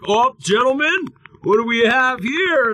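high, hammed-up cop, smug and pleased; mocking cop voice. Retelling a bike cop finding a cap gun, high mock smug voice. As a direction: play the cop who thinks he caught them. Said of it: "they're like".

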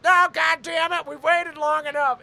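cartoonish dad losing his temper; dad impression shouting; angry dad impression. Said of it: "hearing my dad get angry".

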